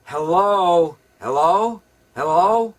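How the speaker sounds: loud, high repeated phone hellos; answering the phone. Retold or imitated. Someone answering the telephone, loud insistent hellos. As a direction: call into a bad phone line. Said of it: "answering the telephone".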